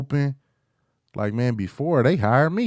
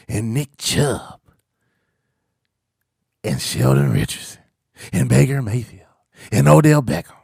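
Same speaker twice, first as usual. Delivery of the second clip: chanted list of names, building louder; hype roll call; 3 semitones higher, 4 dB louder than usual. Real, not acted. Fan listing new Browns players, building hype chant. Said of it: "I'ma just keep saying it over and over again 'cause I'm hype".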